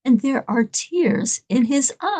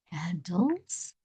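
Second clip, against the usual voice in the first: softly ask, low and rising; acted guru's quiet, low question; much softer, pitched down, ending on a rise; hushed questioning; 7 semitones lower, 11 dB quieter than usual.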